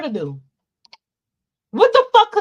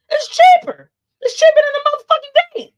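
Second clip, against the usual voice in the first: much higher and louder, punching cheaper; emphatic shout; 10 dB louder than usual. Real, not acted. To men wanting sex from dates, a loud high punchline. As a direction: hammer home cheaper like a punchline.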